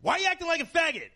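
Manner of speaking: high, fast mocking mom voice; mother's harsh words. Mother scolding her son, fast and high. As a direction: snap it out as a shrill-pitched angry mother. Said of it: "her exact words were".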